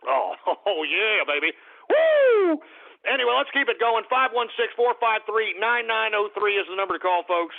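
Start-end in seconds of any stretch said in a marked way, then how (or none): 1.9-2.6 loud high whoop; radio host whooping in celebration; celebratory whoop. Real, not acted. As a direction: let out a party woo. Celebratory cheer on air.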